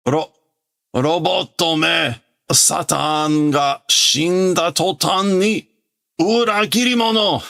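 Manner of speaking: high, slow, seething curse rising to a shriek; hammed-up villain fury; furious old villain. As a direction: spit contempt at the traitors. Villain curses the traitor robots.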